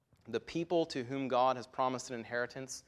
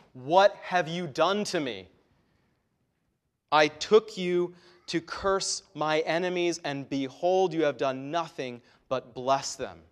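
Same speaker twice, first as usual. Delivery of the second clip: loud, higher, outraged king's complaint; indignant anger; 3 semitones higher, 8 dB louder than usual. Acted. King Balak scolding the prophet, indignant and loud. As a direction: accuse him like a betrayed king. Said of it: "You can practically hear the anger, the indignation in his voice".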